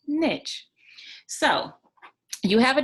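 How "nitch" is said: "niche" with short i and tch; niche said "nitch". Shown as a demonstration. Coach names own preference, niche said "nitch". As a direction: say "niche" like itch with an n. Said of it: "I prefer to say niche".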